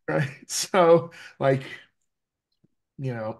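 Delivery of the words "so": ordinary talk, nothing marked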